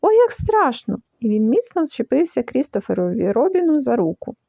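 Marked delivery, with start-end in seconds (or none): 0.0-1.0 high, scared exclamation; frightened. A narrator's reading. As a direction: let fear lift the pitch. Piglet terrified, clutching his friend. (перелякано)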